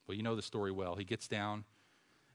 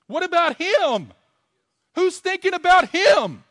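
shouted plea; 16 dB louder than usual. Real, not acted. Preacher to congregation, shouted pleading question. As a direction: cry it out to the whole room.

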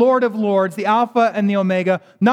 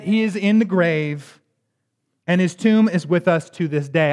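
ordinary delivery

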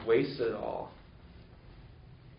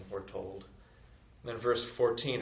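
ordinary talk, nothing marked

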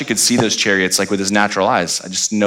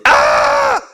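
short low hammed-up yelp of panic; a comic shout of alarm; mock scream; 10 semitones lower, 4 dB louder than usual. Preacher mimics the terrified servant with a comic yelp. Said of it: "Which is Hebrew for".

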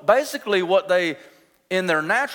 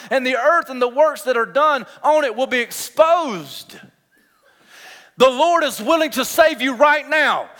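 high, impassioned pulpit warning; full-force preaching; 8 semitones higher, 7 dB louder than usual. Real, not acted. Preacher warning congregation of the Lord's coming day, high and urgent. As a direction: drive the warning out in ringing phrases.

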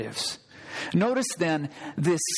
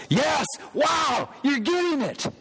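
spring the answer on them with delight; excited exclamation; 8 semitones higher than usual. Real, not acted. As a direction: pastor teasing the congregation, excited. Playful answer to the congregation.

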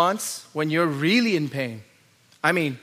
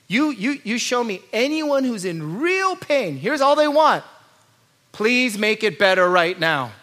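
louder, higher, urgent preacher voice; preaching at full force; 5 semitones higher, 5 dB louder, slower than usual. Acted. Preacher urging the congregation, in full preaching force. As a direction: press the point home from the pulpit.

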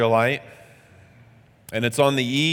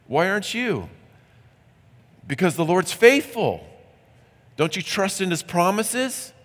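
higher and fast, certain and incredulous; confident insistence; 7 semitones higher than usual. Acted. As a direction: press it home, much higher and quick. Confident man reassures and scolds.

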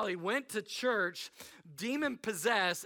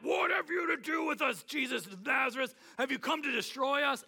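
high, raised acted outcry; crying out; 7 semitones higher than usual. Possessed man challenging Jesus in the synagogue, crying out. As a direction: call it out like a tormented man confronting someone. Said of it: "he cried out".

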